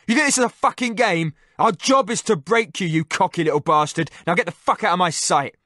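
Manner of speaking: loud, high snarl of contempt; angry. Acted. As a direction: break him with your voice. Interrogator berates captive.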